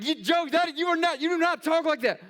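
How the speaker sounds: high, loud mock protest; hammed-up scandalised disbelief; disbelieving wife. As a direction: imitate an incredulous wife objecting. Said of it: "my wife's like".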